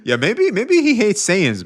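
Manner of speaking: high, loud villain voice; hammed-up high alien overlord; Frieza impression. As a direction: sneer it like a cartoon tyrant.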